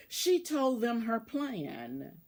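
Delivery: plain reading voice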